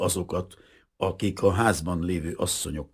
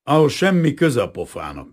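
high, loud, dismissive retort; grumbled rebuff; 9 semitones higher, 12 dB louder than usual. A narrator's reading. Officer rebuffs the American, in irritated refusal. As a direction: brush off the question angrily.

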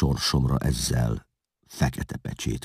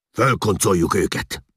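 high, loud, moderately voiced roar; narrated bellow; 7 semitones higher, 6 dB louder than usual. A narrator's reading. Urkon urges the slaughter of Roman servants, restrained bellow. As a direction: call for blood with a raised voice. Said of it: "üvöltötte Urkon".

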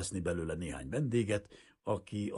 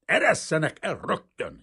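raised, forceful hissed demand; angry hissing; 6 semitones higher, 6 dB louder than usual. A narrator's reading. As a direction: hiss it at the men holding you. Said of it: "sziszegte Kazimír". (dühösen sziszegve)

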